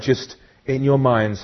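normal talking voice

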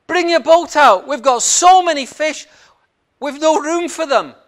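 loud, high shout to the other boat; calling out. Acted. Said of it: "So he calls".

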